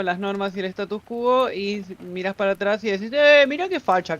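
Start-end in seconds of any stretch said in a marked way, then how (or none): 3.1-4.2 loud, high, scandalized mock outburst; mocking exclamation. Mocking a past self in hindsight.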